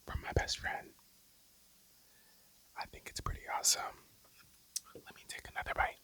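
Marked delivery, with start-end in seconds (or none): none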